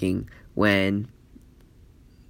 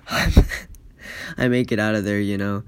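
laughing retelling; 2 semitones lower, slower than usual. Real, not acted.

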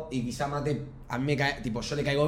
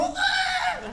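put-on child's cry. Child shaken by mother.

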